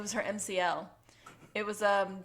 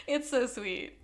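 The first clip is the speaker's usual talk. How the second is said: normal talking voice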